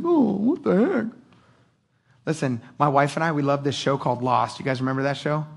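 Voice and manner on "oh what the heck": mock whining. Mock wallowing complaint.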